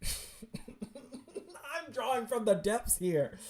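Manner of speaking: slow words through high laughter; laughing. Real, not acted. Reader laughing at their own character voices, cracking up.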